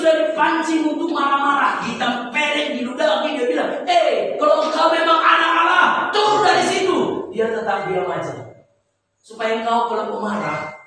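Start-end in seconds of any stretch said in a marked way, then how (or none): 3.9-7.3 higher, jeering challenge from below the cross; mocking taunt. Mockers jeer at Jesus on the cross, higher taunt. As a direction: sneer it up at him.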